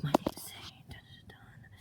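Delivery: hushed unvoiced whisper with suspense sting; whispered dramatic intro. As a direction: whisper it like a mysterious reveal.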